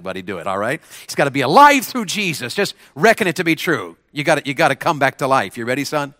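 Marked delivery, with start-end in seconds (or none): 1.1-5.6 dramatic. Shown as a demonstration. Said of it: "Make this dramatic".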